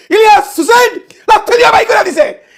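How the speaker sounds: a mocking shout broken by laughter; laughing shout. Real, not acted. Host jeering at Panna's firing, laughing and shouting. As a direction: jeer as you laugh at him.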